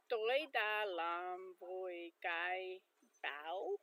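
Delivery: "tôi đã làm vui cái bao" said slowly, "bao" dropping low; Vietnamese phrase, slowly. Shown as a demonstration. Learner showing the next word to viewers, slow and careful. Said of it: "And if it's".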